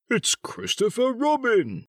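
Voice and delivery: high, drawn-out delighted cry; eager. A narrator's reading. Pooh spotting Christopher Robin, eager. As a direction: announce a friend's arrival eagerly. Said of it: "said Pooh eagerly".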